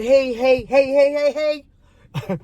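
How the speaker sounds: high, repeated cut-in; interrupting. Retold or imitated. Retelling a try to halt the date's monologue, insistent interrupting. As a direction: keep butting in to stop a nonstop talker. Retold attempt to interrupt him.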